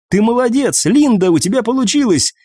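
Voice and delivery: high, elated congratulation; excited praise. A narrator's reading. Eva praises the healer's success, excited. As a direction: pitch way up, delighted with her success.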